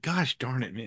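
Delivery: ordinary delivery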